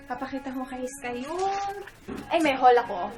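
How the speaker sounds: normal talking voice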